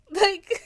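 high squealing laugh. Real, not acted. Vlogger cracking up at the Gatsby quote, squealing laugh. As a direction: burst into giggles.

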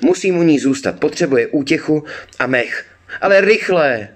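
high, insistent, rising to a shout; urgent cry. A narrator's reading. Girl urges her brother to hurry for the mare, crying out. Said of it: "vykřikla Roňa".

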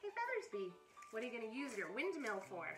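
plain reading voice

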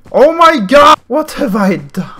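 high, loud outcry, easing off slightly; horrified shout. Real, not acted. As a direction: cry out at a terrible mistake. Horrified at own move in game.

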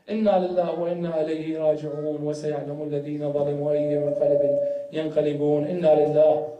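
low, fast recited Arabic; closing Arabic recitation. Real, not acted. Closing Arabic recitation after the lament.

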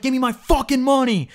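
high, loud mock yell; imitating a furious shout from the car window; retold shouting. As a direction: demand the money back at the top of your voice. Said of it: "This kid's shouting".

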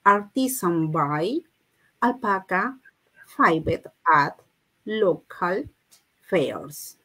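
slow phrases with gaps between them; repeat-after-me model. Shown as a demonstration. Teacher asking learners to repeat after her, slow chunked English. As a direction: model each chunk slowly for repetition. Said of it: "Repeat after me".

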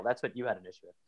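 ordinary talk

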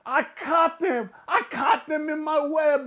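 high, smug mocking voice; mocking Tommy. Ranting vlogger mocking Tommy's gloat. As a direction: mimic a gloating know-it-all. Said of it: "here comes old Tommy to be like".

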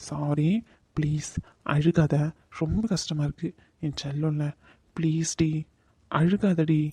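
low, hushed coaxing; soft pleading apology. Acted. Man soothing his crying partner, soft and pleading. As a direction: gently beg forgiveness, keeping it soft.

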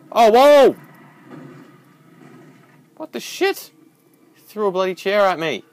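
high, loud shocked outburst; startled shout. Real, not acted. Player hit by a thrown chair, startled shout. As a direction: yell in disbelief as the chair flies.

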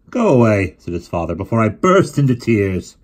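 loud hammed-up despair; a father's mock tragic plea; mock exasperation. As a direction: pretend to be overwhelmed. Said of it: "father mock grief".

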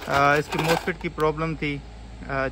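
ordinary talk

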